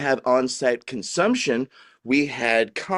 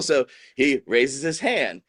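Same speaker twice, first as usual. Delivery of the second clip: normal talking voice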